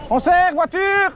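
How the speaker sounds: warning call. Real, not acted. Avertit les enfants d'une voiture. (avertissement lent et crié)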